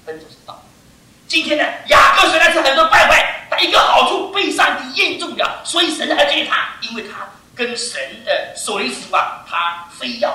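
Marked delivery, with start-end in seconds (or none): 1.3-6.8 loud, high proclamation; preaching shout. Real, not acted. Preacher proclaiming Jacob's one virtue, shouting. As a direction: drive the point home loudly.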